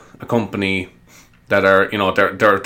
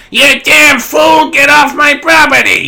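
put-on much higher, louder, slow shrieking old lady; angry old lady; 8 dB louder, slower than usual. Old lady chasing the burglar off her property, a mock shriek. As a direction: scream at him as an old woman, hammed-up. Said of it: "they all have really strong accents".

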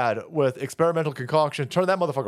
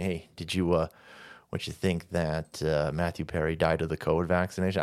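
mimicking a curious fan; 13 semitones lower, 6 dB quieter than usual. Ranting streamer mimics a fan asking about Perry, low and soft. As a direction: lower and softer mock question voice. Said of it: "people will be asking me".

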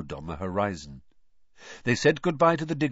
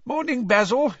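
cheery greeting; 14 semitones higher, 7 dB louder than usual. Acted.

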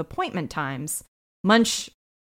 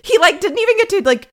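ordinary talk

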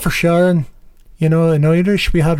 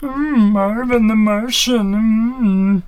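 higher, slow cartoon Martian; Marvin the Martian; 6 semitones higher, much slower than usual. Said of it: "some other cartoon voices".

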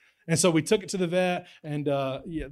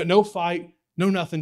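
ordinary delivery

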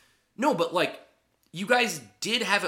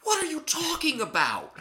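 incredulous; 13 semitones higher than usual. Retold or imitated. Baffled at Matt's answer.

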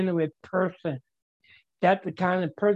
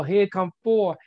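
ordinary delivery

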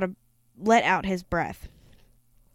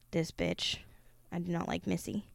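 muttered aside; 3 semitones lower, 12 dB quieter than usual. Real, not acted.

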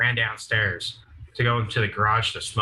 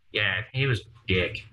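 ordinary talk, nothing marked

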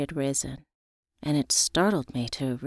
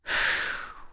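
long breathy exhale of relief; a big relieved sigh; 6 dB quieter than usual. A narrator's reading. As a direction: blow out the tension. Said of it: "let out a tremendous sigh of relief".

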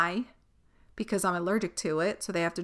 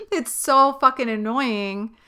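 annoyed laugh; 5 semitones higher, 5 dB louder, slower than usual. Real, not acted.

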